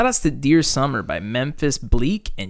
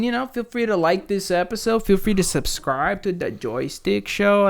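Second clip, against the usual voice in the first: higher, put-on outro voice; mock host voice; 6 semitones higher, 4 dB quieter than usual. Signing off the episode in a put-on host voice. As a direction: sell the outro like a cheesy host.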